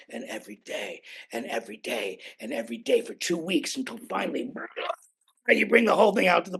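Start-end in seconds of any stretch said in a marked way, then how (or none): 0.1-4.5 low, soft, repetitive drone; hushed repetition. Real, not acted. Telling of two weeks of daily ritual, low and hushed. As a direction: sink down low and repeat like an endless routine.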